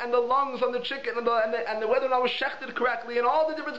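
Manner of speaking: usual speaking voice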